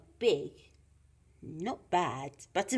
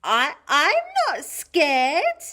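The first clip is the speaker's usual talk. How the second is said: high, loud, stammered denial; Gruffalo's child, fearful bravado; 9 semitones higher, 10 dB louder, slower than usual. Acted. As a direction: protest slowly in a little child's voice. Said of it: "said the Gruffalo's child".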